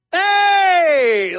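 high, loud cheery shout back. Retold or imitated. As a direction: bellow a happy "Hey!" across the street. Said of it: "Like that".

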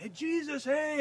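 high calls of hey, Jesus; a hammed-up crowd shout; mock crowd yelling. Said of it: "they're probably all yelling".

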